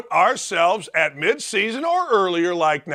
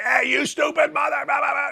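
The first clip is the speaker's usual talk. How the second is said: mock yell; 6 semitones higher than usual. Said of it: "You can't go yelling back at the guy".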